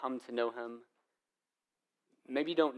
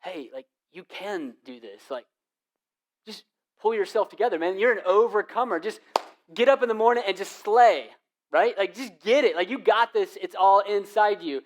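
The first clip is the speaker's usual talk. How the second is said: loud pumped-up motivational hype; bad counsel hype; 5 semitones higher, 6 dB louder than usual. Pastor mocking pushy self-help hype. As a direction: hype them up like a cheesy life coach. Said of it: "the bad counsel would say this".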